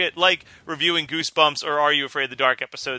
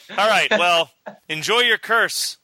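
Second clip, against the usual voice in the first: ordinary talk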